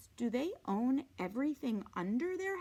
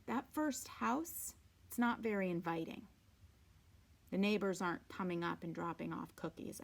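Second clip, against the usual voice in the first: plain reading voice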